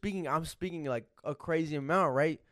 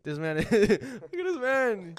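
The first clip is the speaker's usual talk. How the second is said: slow amused laugh through words; 6 semitones higher, much slower than usual. Real, not acted. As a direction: laugh while saying it. Laughing at friend lifting bottles.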